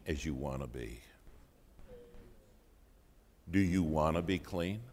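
low, soft, measured voice of God; God speaking inwardly. Retold or imitated. The Lord answering a struggling preacher, low and soft. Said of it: "the Lord spoke to my heart".

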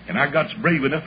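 ordinary talk

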